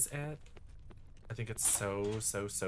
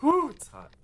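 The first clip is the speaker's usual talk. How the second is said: mock overheated gasp; 10 dB louder than usual.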